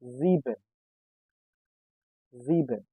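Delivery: Sieben said "ZEE-ben", twice; Sieben, S sounded as z; German pronunciation. Shown as a demonstration. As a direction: start each Sieben with z. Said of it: "sounds as Z".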